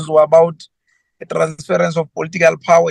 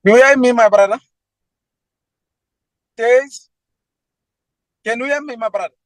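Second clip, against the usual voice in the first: raised, high calling voice; shouting into a phone; 6 semitones higher, faster than usual. Real, not acted. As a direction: call out over a bad line. Caller on a bad phone line.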